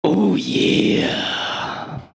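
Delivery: raspy whispered oh yeah; wrestler voice. Acted. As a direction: growl it like a wrestler. Wrestler catchphrase response.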